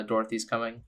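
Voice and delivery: normal talking voice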